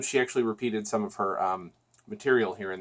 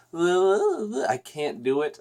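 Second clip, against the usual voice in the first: attempted singer's voice; 7 semitones higher, much slower than usual. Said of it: "but she just has this".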